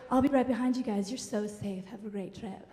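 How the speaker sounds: soft, trailing hippie guide; lightly voiced granola type, fading out; airy hippie guide. River guide sending the family off, airy hippie. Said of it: "Aryan hippie granola kind of freak".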